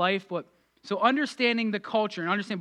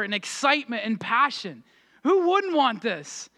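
higher-pitched, emphatic preacher's appeal; impassioned rebuttal; 5 semitones higher, 4 dB louder than usual. Retold or imitated. Preacher answering people who say no for others, impassioned. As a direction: make the case with fervour, pitch lifted. Retells his impassioned reply to doubters.

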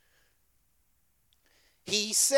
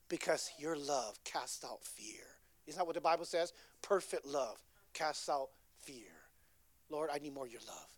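whispered prayer; 6 semitones lower, 9 dB quieter than usual. Acted. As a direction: breathe the prayer out.

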